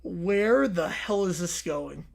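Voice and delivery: ordinary delivery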